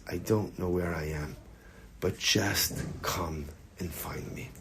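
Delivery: soft, low pleading, slowing down; retold lost child's plea. Retelling a kid on the phone to parents, softly pleading. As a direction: plead quietly as a lost kid, lightly voiced.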